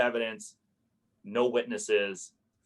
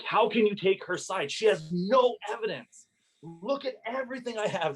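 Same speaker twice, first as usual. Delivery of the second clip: high-pitched angry outburst at the judge; angry snapping; 12 semitones higher than usual. Retold or imitated. Landlord snapping at the hearing officer in court, high angry outburst. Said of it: "That I'm snapping in court".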